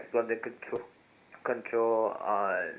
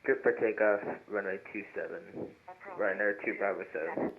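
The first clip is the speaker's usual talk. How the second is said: pilot over the radio; 10 dB quieter than usual. Real, not acted. Pilot reading back on radio.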